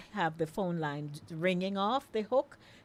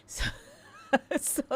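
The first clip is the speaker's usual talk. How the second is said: chuckling at a surprising photo sent in; laughing; 7 semitones higher than usual. Real, not acted. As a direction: giggle through the words at the odd photo.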